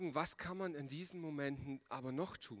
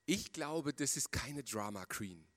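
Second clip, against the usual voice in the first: ordinary delivery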